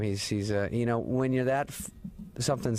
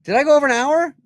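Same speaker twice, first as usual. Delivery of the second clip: much higher and louder, startled; genuinely shocked at the time; host realizing the talk ran long; surprised; 13 dB louder than usual. Real, not acted. Surprised at show length.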